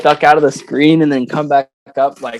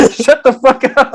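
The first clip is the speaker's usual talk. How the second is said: higher voice, breaking up with laughter; retold laughing protest; 10 semitones higher than usual. Friend retelling Catherine's reaction to the repeated bit, laughing. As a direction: imitate someone exasperated but cracking up. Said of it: "She's like".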